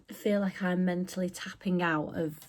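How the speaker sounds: usual speaking voice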